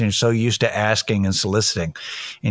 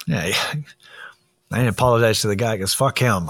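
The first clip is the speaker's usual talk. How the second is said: laughing whisper, half to himself; whispered aside. Real, not acted. Telling viewers about the stranger, a laughing whispered aside. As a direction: let it slip out as a giggling whisper.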